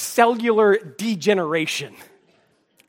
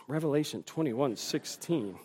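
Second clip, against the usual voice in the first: soft, low mumbling while squinting at small print; squinting to read; 5 semitones lower, 15 dB quieter than usual. Shown as a demonstration. Aging pastor showing he can't read the Bible, squinting murmur. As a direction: peer at the page and mumble. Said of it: "I would do this. Now I do this".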